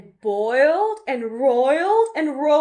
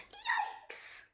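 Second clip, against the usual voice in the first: squeaked, much higher yikes; a tiny frightened squeal; yelled yikes; 17 dB quieter than usual. Acted. Betty fleeing the goo with the cat, squeaked. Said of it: "Betty yelled".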